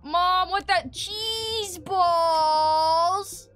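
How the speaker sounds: loud, high, drawn-out whine; mock wailing kid. Kid telling Mom he did drugs, mock wailing. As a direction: wail to mom with long stretched vowels.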